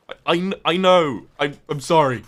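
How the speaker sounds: loud, high, stammering mock outburst; exasperated apology. Answering everyone who criticises the accent, loud flustered mock apology.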